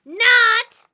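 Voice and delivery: put-on full-out furious child's scream. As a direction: scream it back at him. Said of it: "I screamed back".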